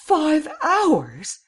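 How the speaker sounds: very high, loud exclamation; acted high outburst of disbelief; appalled cry. A narrator's reading. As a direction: cry it out aghast. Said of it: "cried Miss Cummins".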